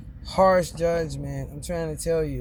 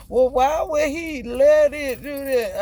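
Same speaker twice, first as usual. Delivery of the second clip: quoting him; 9 semitones higher, 5 dB louder than usual. Retold or imitated. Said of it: "He said".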